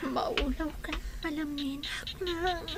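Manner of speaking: faint and weak. Acted. Sick daughter telling mother she feels dizzy, faint. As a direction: speak as if about to pass out.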